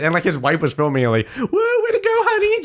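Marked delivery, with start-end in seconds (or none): 1.4-2.6 very high cheering shout; high excited mock cheer. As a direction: cheer on your husband. Wife cheering while filming.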